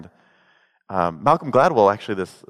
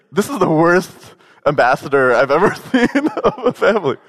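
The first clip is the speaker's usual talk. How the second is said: higher, louder, laughing through it; laughing aside; 7 semitones higher, 4 dB louder than usual. Retold or imitated. Joking about the brother, laughing and higher. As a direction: crack up mid-line. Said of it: "And I was like".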